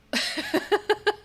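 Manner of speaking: high, loud embarrassed laugh; burst of laughter. Real, not acted. Finding her face on a stranger's page, a loud laugh. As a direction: laugh it off, caught off guard.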